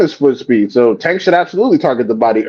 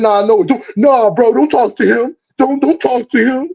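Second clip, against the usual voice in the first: mocking the hangers-on; 8 semitones higher than usual.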